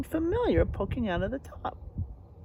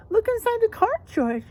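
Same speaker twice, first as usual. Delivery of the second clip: higher eager urging; eager animals; 12 semitones higher than usual. Acted. The animals tell George to read the card, high and eager. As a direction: chirp it as excited animals.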